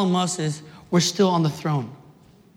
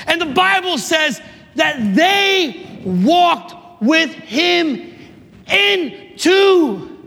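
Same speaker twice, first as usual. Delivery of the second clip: high, stretched-out preacher's cries; rousing sermon climax; exultant preaching; 8 semitones higher than usual. Real, not acted. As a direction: build the miracle with big emphatic beats. Preaches the healing at full force.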